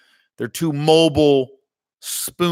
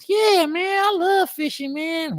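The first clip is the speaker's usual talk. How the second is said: put-on high, twangy country-boy voice, pitched way up; mock country fishing fan, much higher; imitating country buddy. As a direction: ham up the road manager's good-ol'-boy drawl. Brad voiced in fishing story.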